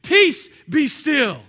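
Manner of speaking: loud, slow, drawn-out command; Jesus commanding the storm. Acted. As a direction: speak it with full authority over the storm. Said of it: "Jesus said".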